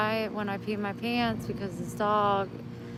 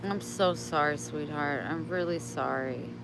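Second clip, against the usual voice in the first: softer, tender apology to a pet; 6 semitones lower, 7 dB quieter than usual. Real, not acted. Owner apologizing tenderly to her dog, soft. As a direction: comfort him gently.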